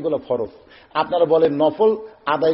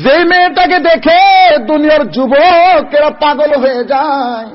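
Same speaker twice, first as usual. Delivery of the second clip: full-force preaching; 10 semitones higher, 9 dB louder than usual. Real, not acted. Preacher tells the crowd of a beautiful girl, full-force preaching. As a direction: preach it out loud with stretched words.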